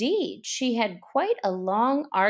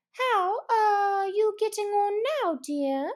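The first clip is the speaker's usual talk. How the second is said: a touch of gentle concern; 11 semitones higher than usual. Acted. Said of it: "turning to Alice".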